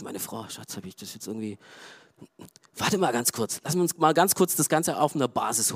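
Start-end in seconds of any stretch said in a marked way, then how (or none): none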